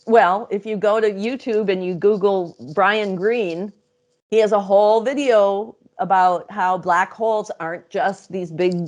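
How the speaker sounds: usual speaking voice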